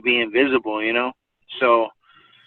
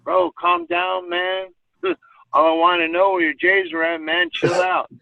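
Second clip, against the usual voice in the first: mock calm-down friend; 7 semitones higher than usual. Friend calming him, mocked.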